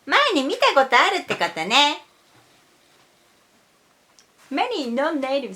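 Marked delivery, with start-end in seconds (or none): 0.0-2.0 acted Japanese exchange. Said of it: "If you say".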